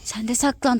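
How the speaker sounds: soft conspiratorial whisper; whispering while hiding. Pretend. Hiding during the game.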